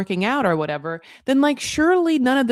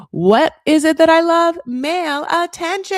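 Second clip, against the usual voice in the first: hammed-up longing; 8 semitones higher, 6 dB louder than usual. Imitating Sophie craving male attention, hammed-up and high. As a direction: belt it out high like a gleeful self-parody.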